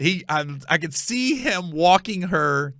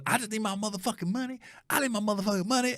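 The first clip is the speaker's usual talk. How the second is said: higher, fast mock demand for her money; imitating the woman; 7 semitones higher, 6 dB quieter, faster than usual. Comic retelling of a woman demanding her money, fast and high. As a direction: mimic her rapid nagging, pitched up. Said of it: "And she goes".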